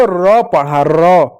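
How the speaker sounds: र said as a drawn-out consonant sound, not "आर"; letter sound र. Shown as a demonstration. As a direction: sound out र slowly with a rolled onset. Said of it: "शब्द में इसको आर नहीं पढ़ा".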